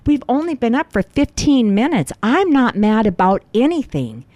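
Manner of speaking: high-pitched, emphatic retold denial; mildly heated protest in retelling; defensive protest. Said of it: "I'm defending myself".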